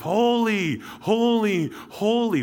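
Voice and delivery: high, loud seraphim cry; yelling. Acted. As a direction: yell it out high, drawing each word out. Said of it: "they're yelling back and forth".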